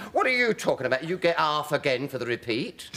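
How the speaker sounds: high, loud scoffing retort; indignant dismissal. Retold or imitated. BBC answering the pay complaint, mock indignant.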